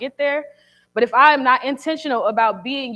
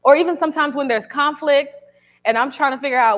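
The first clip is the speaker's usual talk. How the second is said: ordinary talk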